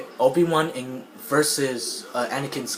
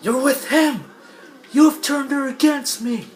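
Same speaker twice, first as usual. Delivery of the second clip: furious high-pitched shout; Anakin; 12 semitones higher, 5 dB louder than usual. Retold or imitated. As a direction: yell the accusation at full pitch. Acted quarrel, enraged Anakin.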